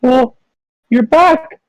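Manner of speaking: high small voices; scared children. Acted. Frightened children greet returning father in high small voices. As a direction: kids nervously greeting dad. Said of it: "and they're like".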